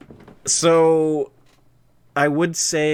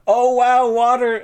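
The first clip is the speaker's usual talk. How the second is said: excited; 11 semitones higher, 8 dB louder, slower than usual. Real, not acted. Laughing at viewer's comment.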